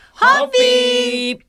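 long high ringing cheer; drawn-out toast cheer. Real, not acted. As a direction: call out the toast, stretching it. Toast cheer after the dedication.

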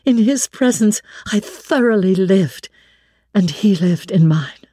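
usual speaking voice

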